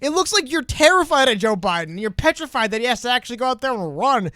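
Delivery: exasperated, telling friends to face facts; mock exasperation. Acted exasperated reply to Biden supporters.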